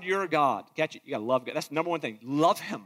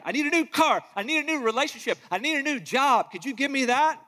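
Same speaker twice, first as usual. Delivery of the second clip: higher, eager wish-list prayer; higher, eager mock petition; mock selfish prayer; 7 semitones higher than usual. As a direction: mimic someone listing wants to God. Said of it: "I just want you to give me that".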